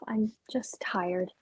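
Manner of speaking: tired unvoiced whisper. [name] to Ralph, whispering that she's tired. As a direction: breathe it out, worn out.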